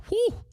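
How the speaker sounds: a very high, sharp whew of admiration; appreciative whew. Real, not acted. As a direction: let out a high whistle-like whew. Reacting to how hot she was.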